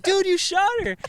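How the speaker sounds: high, loud, stunned accusation; the group yelling at the shooter; shocked yell. Retold or imitated. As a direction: everyone shouting it at once. Everyone reacts to the shooting.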